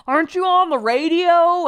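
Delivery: loud, high neighbor impression; exaggerated high-pitched shouted question; mock drunk neighbor. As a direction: holler it like a nosy drunk neighbor.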